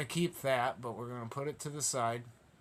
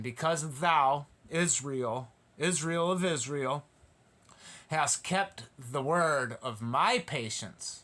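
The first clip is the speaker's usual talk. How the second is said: slow, weighty preacher's reading, leaning on thou; solemn scripture reading; slower than usual. Acted. Preacher to congregation, slow solemn scripture reading. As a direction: read each word as holy writ. Scripture read slowly, emphatically.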